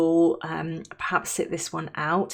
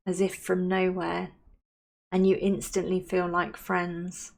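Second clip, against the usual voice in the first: unhurried, soothing visualisation, softening at phrase ends; guided meditation. Acted. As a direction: guide them gently into calm, leaving space to breathe.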